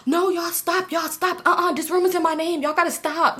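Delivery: high, pleading stop; mock panicked pleading. She begs the fighters to stop, high mock panic. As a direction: plead with them to quit, high and urgent.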